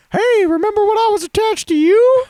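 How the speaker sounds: very high, loud puppet squeal; squeaky ventriloquist's dummy voice; penis puppet. Acted. Ventriloquist's puppet talking to its owner, high squeaky voice. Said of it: "his final act's a penis".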